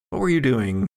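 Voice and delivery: usual speaking voice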